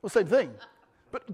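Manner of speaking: ordinary talk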